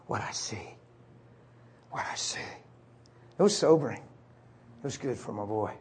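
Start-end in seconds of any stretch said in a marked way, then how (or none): 0.1-2.4 hushed, breath-only murmur; whisper. Retold or imitated. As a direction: whisper it softly while rocking a child. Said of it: "I started to whisper".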